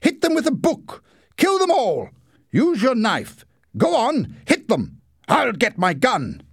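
high, loud frantic orders; excited high-pitched battle cries; shouting. A narrator's reading. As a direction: yell urgent commands in a panic. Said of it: "shouted Leslie".

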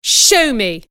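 loud, bossy command. Acted. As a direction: bark the order like a bully. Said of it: "he commanded".

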